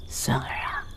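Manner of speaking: confidential whisper. Acted. Elder takes grandson aside, whispering.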